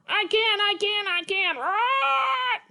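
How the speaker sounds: high, bright puppet character, eager; Petrie puppet voice. Acted. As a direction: play the little pterodactyl, keen to try.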